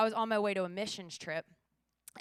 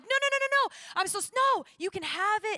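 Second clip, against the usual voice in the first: very high, loud string of frantic no's; hammed-up panic, blurt it loud and high; panicked denial; 12 semitones higher, 9 dB louder than usual. Traveler accused by airport officer, retold high-pitched panic.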